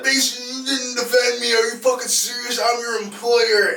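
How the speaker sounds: high-pitched mock indignant tirade; imitated yelling boss. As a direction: play an aggrieved boss telling off staff. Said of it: "Getting yelled at?".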